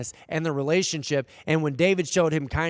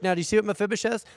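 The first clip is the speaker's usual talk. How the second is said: ordinary delivery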